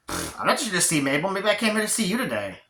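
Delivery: sing-song mocking repeat, swooping high; scoff, then tease with rising pitch; scoffing echo. Scoffing echo of his words.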